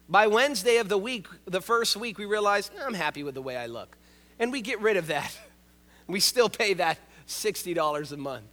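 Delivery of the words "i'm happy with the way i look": low, soft, quick smug aside; a hint of comfortable self-satisfaction, low and quick; mock self-satisfied. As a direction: toss it off like a lazy excuse. Quoting our own thought.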